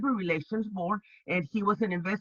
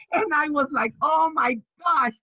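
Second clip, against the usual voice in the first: thrilled exclamation; 7 semitones higher, 5 dB louder than usual. Retold or imitated. Thrilled reaction.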